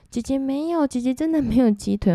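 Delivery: high-pitched anxious denial to a ghost, retold; scared pleading. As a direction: insist you have nothing, begging.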